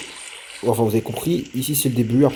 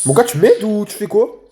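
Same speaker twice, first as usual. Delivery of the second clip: higher, louder exasperated call to a teammate; 8 semitones higher, 4 dB louder than usual. Real, not acted. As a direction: demand help, fed up. Râle contre un allié inactif. (appel agacé à un coéquipier, plus fort et plus aigu)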